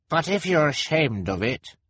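put-on high-pitched child character voice; inquisitive boy. A narrator's reading. Klaus questioning the ashamed contortionist, inquisitive. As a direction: reason with her, pitched well up.

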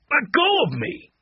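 high-pitched angry protest; angry shout. A narrator's reading. Eddie is held by the sleeve, angry shout. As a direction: protest angrily, pitched well up. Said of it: "he shouted angrily".

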